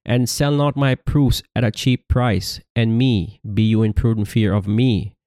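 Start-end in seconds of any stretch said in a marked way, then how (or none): none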